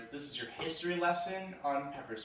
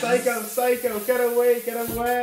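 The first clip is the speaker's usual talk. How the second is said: much higher, loud panicked shriek; 10 semitones higher, 11 dB louder than usual. Acted. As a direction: shriek and run away. Fleeing from lime thief.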